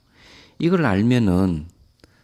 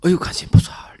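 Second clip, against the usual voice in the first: spontaneous exclamation; 9 semitones higher, 5 dB louder than usual. Retold or imitated. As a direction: imitate an involuntary cry, pitched high and louder. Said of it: "화가 나 자신도 모르고".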